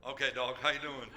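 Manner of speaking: low, offhand mock greeting to the dog; lightly voiced shrug; casual owner. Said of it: "don't just go".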